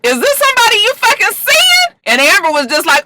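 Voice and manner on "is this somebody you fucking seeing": high, incredulous confrontation; indignant high-pitched questioning; mock outrage. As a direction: imitate a jealous girlfriend, voice shooting up. Acts out jealous girlfriend confronting.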